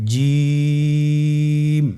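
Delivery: জিম held for three alif, the vowel stretched; drawn-out Jim. Shown as a demonstration. Teacher showing the class how to pronounce জিম. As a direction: demo জিম slowly and clearly. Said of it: "আবার বলুন".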